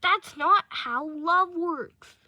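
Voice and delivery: high, loud, drawn-out protest; acted indignant captive cry; listener's voice. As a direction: yell back at your captor, slowly. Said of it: "try to do listener's voice".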